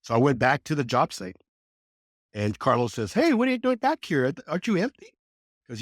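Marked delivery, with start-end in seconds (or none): 3.1-5.1 high-pitched, puzzled callout; imitated surprised greeting. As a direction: retell the foreman's surprise, pitched well up. Foreman's surprised greeting retold.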